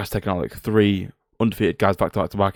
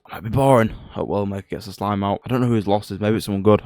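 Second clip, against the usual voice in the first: ordinary talk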